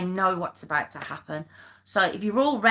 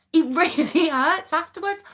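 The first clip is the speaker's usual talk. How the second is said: higher, laughing through it; 7 semitones higher, 4 dB louder than usual. Real, not acted. Telling viewers about her arm, laughing. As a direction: giggle while complaining.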